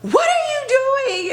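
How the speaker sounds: high, slow, drawn-out shriek; imitating an outraged screech; mock scream. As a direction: stretch it out in shocked outrage. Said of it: "she's screaming at the principal".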